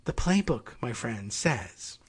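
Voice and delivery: low, hushed playbook line; a lightly hushed mock insider; mock conspiratorial voice. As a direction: lean in like sharing a secret. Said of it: "even if they don't just say".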